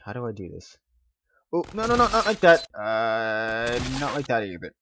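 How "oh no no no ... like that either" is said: frantic high no no no; panicky, fussing over every move; micromanaging. Real, not acted. Steering ragdoll, frantic self-direction.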